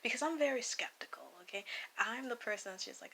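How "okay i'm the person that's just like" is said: ordinary talk, nothing marked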